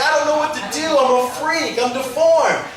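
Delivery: lightly played panic about being a freak; distraught woman. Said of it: "She's like".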